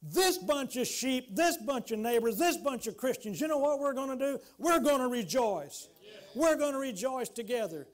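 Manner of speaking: loud, high sermon build; full-force preaching. Real, not acted. Preacher rallying his congregation, loud and fervent. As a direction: preach it out with rising fervor.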